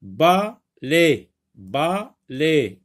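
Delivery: "balai" split into ba... lai, each time; syllable by syllable. Shown as a demonstration. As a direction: say "balai" as two separate beats, ba, then lai. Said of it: "le sectionner en syllabes".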